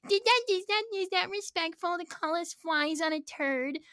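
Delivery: very high put-on lady voice; squealing mock indignation; mock upset. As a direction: imitate an offended protester, high-pitched. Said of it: "this one lady got really upset".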